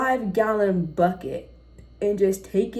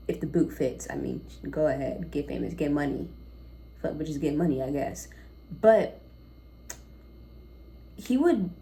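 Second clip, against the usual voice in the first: usual speaking voice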